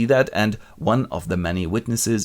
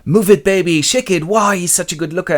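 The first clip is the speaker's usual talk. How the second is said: much higher, louder cheering flirt; high mock flirt; 9 semitones higher, 7 dB louder than usual. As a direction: cheer him on like a fangirl. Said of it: "and Marie goes".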